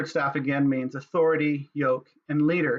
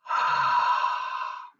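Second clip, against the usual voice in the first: long audible breath in and out; demonstrated breath. Shown as a demonstration. Teacher showing breath as God's name, long conscious breath. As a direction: breathe deliberately, drawn out and airy. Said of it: "what if it's simply breath? It's simply".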